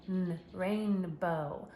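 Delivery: "rainbow" drawn out very slowly; stretched sounding out. Shown as a demonstration. Teacher sounding out rainbow for child, very slow. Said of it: "stretch it out".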